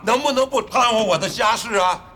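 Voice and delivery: exasperated outburst. Acted. Husband begging her not to meddle in family affairs, exasperated.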